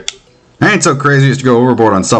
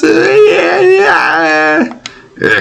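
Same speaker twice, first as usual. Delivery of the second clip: crying; much slower than usual. Acted. Girl breaks down crying.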